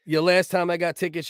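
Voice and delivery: ordinary talk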